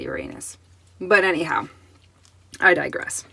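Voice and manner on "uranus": "Uranus" said as yoo-RAY-nus; the popular yoo-RAY-nus pronunciation of "Uranus"; stress on second syllable. Shown as a demonstration. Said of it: "it's more fun to say".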